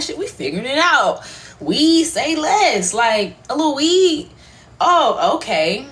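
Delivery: high, loud, sing-out excitement; hammed-up triumphant glee; celebrating. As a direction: crow it like a win, stretching the words. Acting out celebrating with the doctor.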